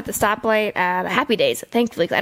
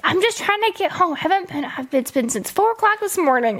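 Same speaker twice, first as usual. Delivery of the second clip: high-pitched mimicry of a pleading driver; mock-pleading, higher lady's voice; retold lady driver; 8 semitones higher than usual. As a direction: imitate her excuse in a much higher voice. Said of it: "the lady was like".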